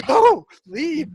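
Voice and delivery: rising, laughing go-away trailing off slowly; shooing him off. Retold or imitated. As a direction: chase him off, half laughing. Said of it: "I'm going".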